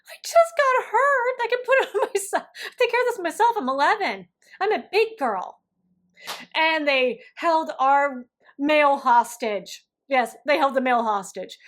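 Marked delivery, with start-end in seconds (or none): 0.1-5.6 put-on high, loud whiny kid protest; indignant kid. Hurt girl to angry counselor, high whiny protest. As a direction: plead like an offended eleven-year-old. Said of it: "and I thought".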